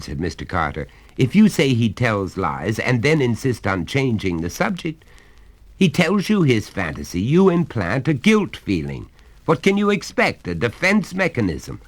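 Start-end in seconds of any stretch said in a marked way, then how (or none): 1.2-11.8 higher, louder jovial father voice; a hearty father coaxing his son; genial father. Acted. Father lectures his wife.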